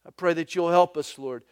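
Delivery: earnest pastoral prayer. Real, not acted. Pastor praying over the congregation. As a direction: pray it sincerely for the room.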